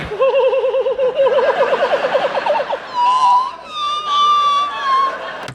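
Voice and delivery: long, very high, loud squealing giggle; Bert Kreischer squeal. Retold or imitated. Comic reenacting nervous giggle in the silent elevator, loud high squeal. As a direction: let a shrieking giggle run out. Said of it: "famous Bert Kreischer squeal".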